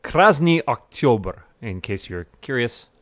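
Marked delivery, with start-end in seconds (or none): 0.0-1.4 "Krasny Oktober" said slowly with a higher, louder Russian ring; Russian pronunciation. Shown as a demonstration. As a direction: show off the Russian "Krasny Oktober", slow and emphatic. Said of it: "I do know Russian".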